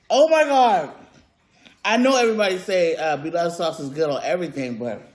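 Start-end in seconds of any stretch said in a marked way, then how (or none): 0.1-0.9 loud delighted oh my God; excited exclaim. Real, not acted. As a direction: blurt out pure delight. Loud exclamation over food.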